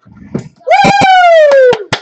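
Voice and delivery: long high loud woo; celebratory squeal; excited whoop. Real, not acted. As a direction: let out a piercing, sustained whoop. Celebrating the announced trip.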